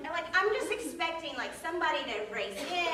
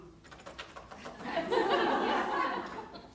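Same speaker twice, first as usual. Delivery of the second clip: laughter; 6 semitones higher than usual. Real, not acted. Audience laughs after punchline.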